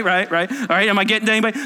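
ordinary delivery

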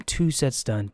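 ordinary talk

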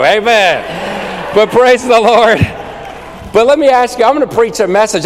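loud, high preacher's call; full-force preaching. Acted. Preacher to congregation, loud rousing praise. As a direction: rouse the congregation with a big shout of praise.

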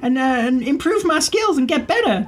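put-on very high, bubbly girl; young girl voice. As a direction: chirp it like an excited girl. GM voices Sandy.

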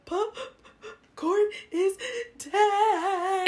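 gasping, high, drawn-out fake wail; gasp, then wail it loudly as if sobbing; mock sobbing. Caller breaks dog's death to friend, hammed-up crying.

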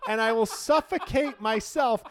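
high, loud laughter through words; laughing. Real, not acted. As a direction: crack up while you say it. Laughing at his own bit.

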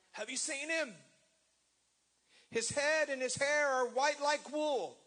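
high, loud, searching cry; desperate bride. Retold or imitated. Solomon's bride asking for her beloved, high desperate calling. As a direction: call out like a bride searching the streets. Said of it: "like Solomon's bride".

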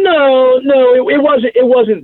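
no no, set the record straight; earnest high protest that cuts in; emphatic denial. Real, not acted. Quick correction of a wrong guess.

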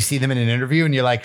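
ordinary delivery

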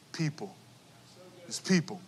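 soft, earnest close; hushed emphasis. Real, not acted. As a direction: let the word sink in quietly. Quiet emphatic ending.

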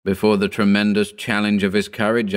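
ordinary delivery, nothing marked

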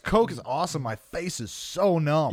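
coked up. Pretend. Acting out a coke high.

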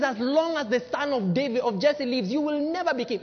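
king Saul warning his son Jonathan; Saul's emphasis. Acted. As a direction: lean hard on never. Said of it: "he emphasized".

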